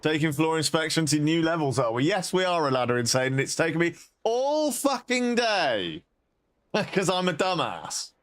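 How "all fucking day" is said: high, drawn-out exasperated complaint. Real, not acted. Complaining how long it took.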